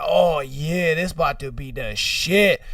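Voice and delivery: low, relishing self-talk; eagerly anticipating viewer. Acted. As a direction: savor it in a low voice. Buyer eagerly starts his tape.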